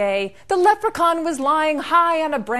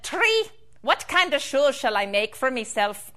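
ordinary delivery

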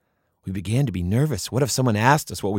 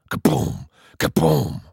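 knock sound effect; 5 semitones higher, slower than usual. Retold or imitated. Imitating knocks on the door.

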